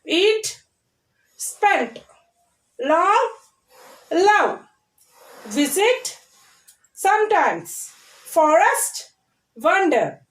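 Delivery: isolated words with long gaps; repeating word drill. Shown as a demonstration. Teacher repeating the word list for children, spaced-out drill. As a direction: model each word clearly for repeating. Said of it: "Once again, I am repeating".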